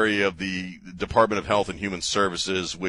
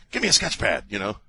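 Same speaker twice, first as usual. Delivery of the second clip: ordinary talk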